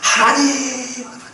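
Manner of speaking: a touch of anguished crying out; desperate plea. Retold or imitated. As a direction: plead with drawn-out vowels. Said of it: "너무 간절한 가운데".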